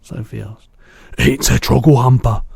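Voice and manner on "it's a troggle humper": louder angry shout; furious shout. A narrator's reading. BFG naming the nightmare, furious shout. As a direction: shout it in fury. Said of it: "he shouted".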